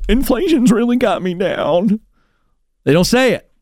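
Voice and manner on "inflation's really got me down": higher, put-on lament; mock complaint. Debt-free screamer before the scream, mock lament. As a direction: lightly imitate someone moaning about hard times. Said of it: "debt-free screamers right before they do their scream going".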